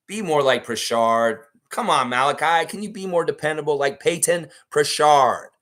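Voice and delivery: loud, exasperated calling out; frustrated yelling. Real, not acted. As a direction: yell at him like a fed-up fan. Yelling at player on stream.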